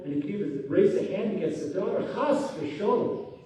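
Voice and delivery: ordinary delivery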